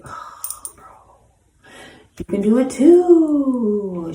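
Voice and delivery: gentle encouragement. Retold or imitated. Encouraging an old friend who is struggling, high and earnest. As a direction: voice rising high and slow, urging her on.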